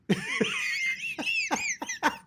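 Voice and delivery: high burst of laughter at his own joke; squeaky giggle; real laugh. Real, not acted. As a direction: crack up at the memory.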